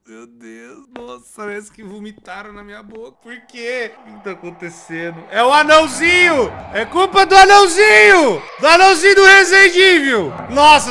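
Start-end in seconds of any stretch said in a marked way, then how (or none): 5.3-10.9 loud, high panicked yelling; panicked loud accusation; alarmed shout. Real, not acted. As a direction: shout the blame at the creepy dwarf. Shouting about scary dwarf.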